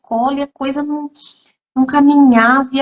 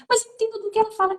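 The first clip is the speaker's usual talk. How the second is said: higher and fast, defensive parent voice; a parent defending the child's speech, higher and quick; mock parent; 11 semitones higher, 6 dB quieter, much faster than usual. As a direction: rattle it off like a parent insisting. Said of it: "o pai fala assim".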